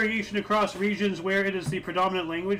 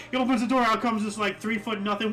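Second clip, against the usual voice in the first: usual speaking voice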